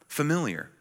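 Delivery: ordinary talk, nothing marked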